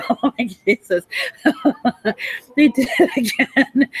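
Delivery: high, breathy laughing through the words; laughing disbelief. Real, not acted. Mocking Anonymous for getting caught again, laughing. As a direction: giggle in disbelief.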